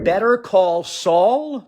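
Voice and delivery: ordinary talk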